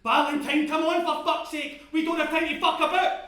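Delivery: loud, high, urgent scolding; exasperated shout. Acted. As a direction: berate a balking mate at full volume. Charlie urges him on, exasperated.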